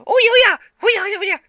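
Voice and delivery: very high, loud squeaky chant; squeaky minions. Acted. Minions chirp excitedly at each other in high squeaks. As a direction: chirp like tiny yellow minions. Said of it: "are like".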